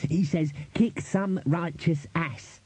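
high, very slow recitation; careful quoting. A narrator's reading. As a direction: quote it word by word for a superior. Ponder repeats the quote carefully.

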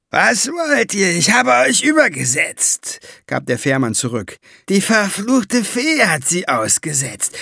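put-on indignant old ferryman; grumbling ferryman. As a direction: ferryman protests at the dwarf, whiny and indignant. Ferryman defending himself.

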